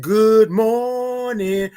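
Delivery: sing-out, long-held morning greeting; drawn-out greeting. Shown as a demonstration. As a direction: hold the vowels long and call it out. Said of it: "gotta say it like that".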